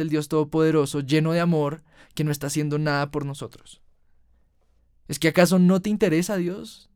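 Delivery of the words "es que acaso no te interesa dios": high, loud, fast accusing yell; angry shout at God. Retold or imitated. Furious at God over the boy's murdered father, shouting. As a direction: hurl the question at God in fury. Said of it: "le grité".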